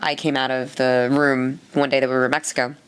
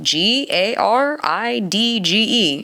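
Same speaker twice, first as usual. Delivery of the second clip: each letter spelt slowly; slow spelling out; 6 semitones higher, much slower than usual. Retold or imitated. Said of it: "he's like".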